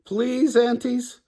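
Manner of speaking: very high pleading to the aunties; begging. Acted. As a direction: keep begging, bright and high. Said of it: "begged Meg and Sean together".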